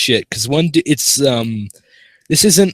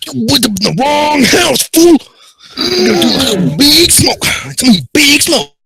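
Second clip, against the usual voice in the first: Big Smoke voice; 7 dB louder than usual. Pretend. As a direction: ham up a squeaky Big Smoke. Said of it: "do a big smoke voice".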